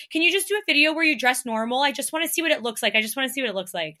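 fast, high pleading fan voice; quick, eager, begging voice; mimicking fans. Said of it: "I get so many questions of people saying".

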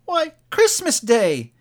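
high, loud, slow exclamation; young boy. A narrator's reading. Boy telling Scrooge the day, amazed young voice. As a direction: announce it in a boy's amazed voice. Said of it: "replied the boy".

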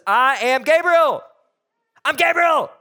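frustrated angel. Pretend. Angel, hands on hips, declaring his name, firm. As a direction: plant your feet and declare it. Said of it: "hands on his hips".

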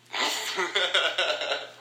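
breaking into a high laugh; amused burst of laughter. Real, not acted. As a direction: laugh at your own silliness. Laughs at own flow attempt.

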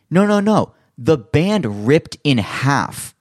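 high, loud no no no, then very slow; emphatic protest. Retold or imitated. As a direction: insist hard, then spell out the rest slowly. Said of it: "He goes".

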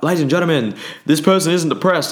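imitating a motivational speaker declaring a win to the audience; mock stage announcement. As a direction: announce it like a seminar host revealing a result. Retells Tony Robbins announcing to crowd.

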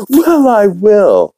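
high, slow, smug Stewie-style reply. Acted. Stewie accepting Brian's bet, high and drawn out. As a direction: stretch it out high like a haughty baby. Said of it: "And Stewie's like".

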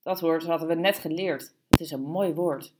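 ordinary delivery, nothing marked

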